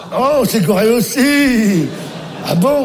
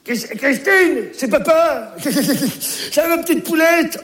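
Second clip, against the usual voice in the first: cheery greeting; 7 semitones higher than usual. Acted. Grandpa greets daughter on phone.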